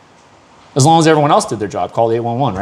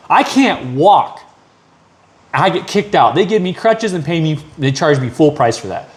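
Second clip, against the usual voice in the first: ordinary delivery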